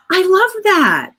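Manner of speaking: high, delighted clerk voice, well above her own; gushing clerk. Retold or imitated. Post office clerk answering a cheerful customer, high delighted gush. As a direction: squeal it like the clerk just fell in love with the phrase. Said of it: "she's like".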